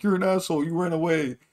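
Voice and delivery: high mock voice of the chat; high-pitched mocking jeer. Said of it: "the chat was just like".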